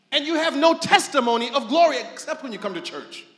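normal talking voice

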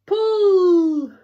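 high, loud, drawn-out heave cry; big acted storybook yell. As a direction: yell it long to haul everyone up. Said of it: "yelled Timothy".

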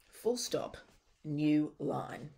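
spoken as a voice command to the computer; dictation command. Shown as a demonstration. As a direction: dictate the punctuation clearly. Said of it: "I'm also gonna say some things that seem a bit odd".

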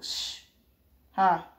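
usual speaking voice

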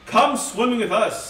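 a light otter voice; inviting otters. A narrator's reading. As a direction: call them in to swim. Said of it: "said the otters".

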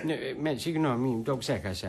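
low, soft mumbled excuse; deflated, quiet and low; sheepish apology. Acted. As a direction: back down meekly when told off. Drunk apologises after being scolded.

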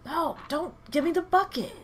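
frustrated yell. Acted. Streamer scolding her game companion, exasperated. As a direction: snap at the clumsy dog.

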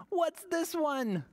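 high, slow wondering question after a gasp; wide-eyed, drawn-out wonder; mock surprise. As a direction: gasp and marvel at the new book. Reveals new book to children.

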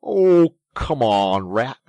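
loud, exasperated groan falling from high; impatient. A narrator's reading. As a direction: hurry a dawdling friend along. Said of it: "the mole waited impatiently".

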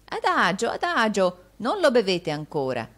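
voce alta e forte, da vecchio ansioso; vecchio avaro agitato. Acted. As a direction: frena l'ospite con urgenza stridula. Vecchio avaro trattiene l'ospite. (vecchio avaro che esclama più alto e forte)

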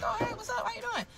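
a high, breathy-light hello kept small and soft; sweet, high, soft greeting. Retold or imitated. Greeting his so-called sister on FaceTime, high and soft. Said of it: "I'm like".

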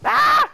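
very high, loud panicked scream. Real, not acted. Gamer getting sniped, very high panicked scream. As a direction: shriek as the shot lands.

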